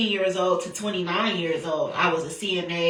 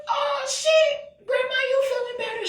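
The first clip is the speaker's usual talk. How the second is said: shocked shout. Retold or imitated. Shocked grandma suddenly talks.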